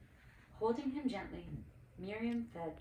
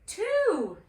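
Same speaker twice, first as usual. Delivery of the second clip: much higher, louder joyful cry; excited call; 13 semitones higher, 12 dB louder than usual. A narrator's reading. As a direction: shout the number with delight. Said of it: "she called".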